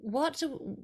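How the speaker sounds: ordinary talk, nothing marked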